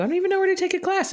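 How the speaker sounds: high, rapid retold objection; fast, high-pitched imitated bewilderment; flustered protest. Retells protest to girlfriend's suggestion.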